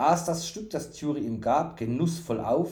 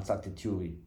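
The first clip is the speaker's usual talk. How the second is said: usual speaking voice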